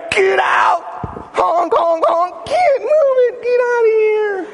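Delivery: high furious yell at drivers; road rage yelling. Pretend. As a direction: yell and honk at traffic. Acting road rage at strangers.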